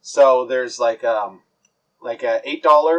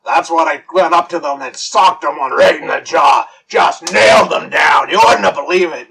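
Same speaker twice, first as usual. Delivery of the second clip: loud bragging shout; boastful brawler Big Joe; 2 semitones higher, 5 dB louder than usual. Acted. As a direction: boast loudly to the bar. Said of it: "he's like".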